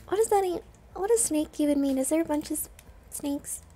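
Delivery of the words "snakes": ordinary delivery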